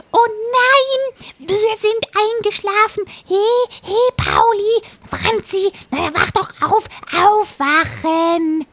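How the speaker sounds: high, loud panicked parrot. Acted. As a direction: urgently wake your friends. Parrot waking friends in panic.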